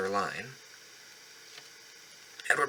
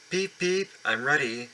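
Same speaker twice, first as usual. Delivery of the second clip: cheerful little engine; 5 semitones higher than usual. Acted. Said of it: "said Edward".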